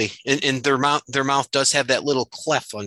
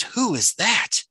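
read in character; 10 semitones higher, slower than usual. Acted. Comic reading, Baxter line.

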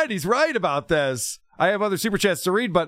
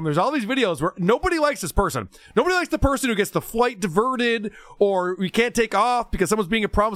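normal talking voice

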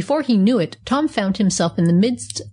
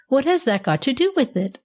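angry, nervous; 6 semitones higher than usual. A narrator's reading. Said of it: "cried the kangaroo angrily".

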